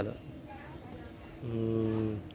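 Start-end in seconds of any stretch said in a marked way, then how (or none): none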